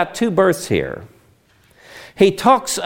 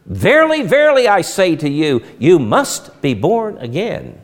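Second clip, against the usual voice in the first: solemn, emphatic declaration; 2 semitones higher than usual. Retold or imitated. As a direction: drive it home firmly, stretching out "you must be born again". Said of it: "It's emphatic. He could not be more emphatic".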